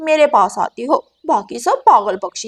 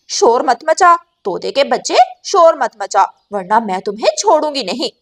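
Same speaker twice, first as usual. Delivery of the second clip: louder warning, last line rushed; angry threat; 8 dB louder than usual. Acted. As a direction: hiss a threat to shut him up. Gauri threatens the parrot.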